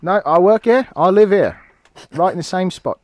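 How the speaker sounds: high, loud Cockney patter; London street urchin accent. Acted. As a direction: answer as a cheeky Cockney street boy. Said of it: "that guy right there".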